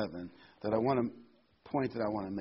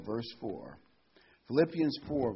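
normal talking voice

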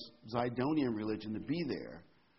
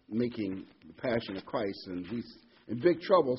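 ordinary talk, nothing marked

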